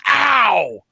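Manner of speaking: loud, high "ow" of pain; sudden sharp yelp. Acted. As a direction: cry out as if your foot is crushed. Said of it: "you just hear this".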